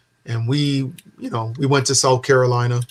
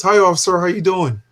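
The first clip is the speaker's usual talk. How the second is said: mock friendly greeting; 5 semitones higher, 3 dB louder than usual. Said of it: "and he goes".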